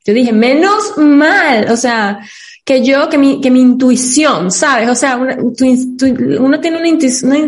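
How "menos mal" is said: "Menos mal" muy lento y arrastrado, con alivio; alivio alargado. Retold or imitated. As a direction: estira cada sílaba como quien suelta el aire. Alivio al recordar su decisión. (alivio lento y alargado)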